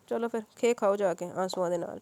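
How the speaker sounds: impatient, loud dismissal; bored policeman cutting it short; fed up. Acted. Policeman tells the family to stop the drama, irritated. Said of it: "اکتا کر بولا".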